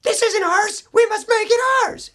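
very high, loud squeaky animal voice; pet voice. Retold or imitated. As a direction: voice the pets as shrill little creatures. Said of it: "our dogs and cats were like".

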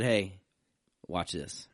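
ordinary talk

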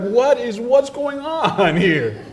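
high, alarmed mock shriek of a woman startled; shocked woman. Pastor acting out the startled woman in the yard, high and alarmed. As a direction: play the shocked lady, laughing through it.

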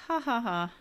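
high, mock ha-ha-ha; imitated laugh. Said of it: "the Ed guy is all like".

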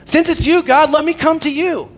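high, loud, excited call; Peter's rash plea. Retold or imitated. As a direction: call out over a crashing storm, slightly hammed-up. Acting out Peter's rash request in storm.

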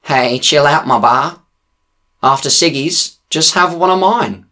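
high nasal stoned dude voice; lightly voiced nasal stoner, laid-back. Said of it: "a nasally voice next to me says".

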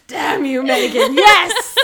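busted, yell it playfully; caught-out exclamation. Retold or imitated. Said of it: "she was like".

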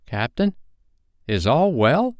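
high, tentative question; mildly timid crewman's inquiry. A narrator's reading. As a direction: ask the captain cautiously, pitch lifting. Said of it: "they asked timidly".

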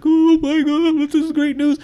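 very high imitated weepy joy; mock happy crying. New dad imitating relatives hearing his news, mock tearful joy. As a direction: gush it in a squealing put-on voice. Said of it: "they're so happy and they're crying".

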